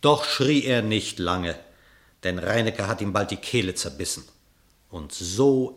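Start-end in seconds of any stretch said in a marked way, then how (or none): none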